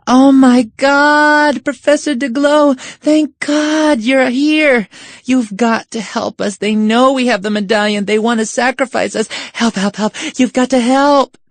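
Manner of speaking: high, loud, desperate plea for help; hammed-up panic. Girls begging the professor to save them, panicked. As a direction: cry out in mock terror, high and loud.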